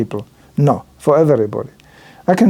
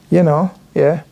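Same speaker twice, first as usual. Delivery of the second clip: ordinary talk, nothing marked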